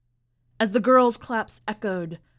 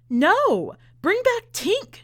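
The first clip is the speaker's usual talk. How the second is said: high, loud desperate scream; 12 semitones higher, 7 dB louder, slower than usual. Acted. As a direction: scream it at the stage, begging. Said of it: "she screamed".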